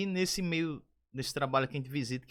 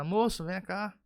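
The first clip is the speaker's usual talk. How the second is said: raised, louder call to someone; calling out, retold; 6 semitones higher, 5 dB louder, slower than usual. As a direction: imitate calling a man over, higher-pitched. (chamando alguém, imitado)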